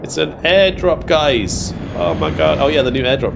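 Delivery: high, loud, excited shout. Real, not acted. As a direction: call out the airdrop excitedly. Airdrop appears in game.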